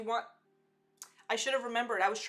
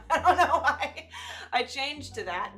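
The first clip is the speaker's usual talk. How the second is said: real laughter, louder and higher; laughing; 5 semitones higher, 9 dB louder than usual. Real, not acted. Streamer laughing at herself for going Southern, laughing. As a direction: laugh through the words. Laughing at her own accent switch.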